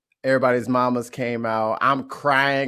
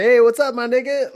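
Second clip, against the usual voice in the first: much higher, louder breezy mock greeting; mock casual greeting; 11 semitones higher, 8 dB louder than usual. Someone dropping the word as a greeting, hammed-up breezy call. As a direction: toss it off like a careless buddy.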